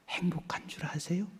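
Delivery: hushed, intimate question; whispered. Real, not acted. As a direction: lean in and whisper it.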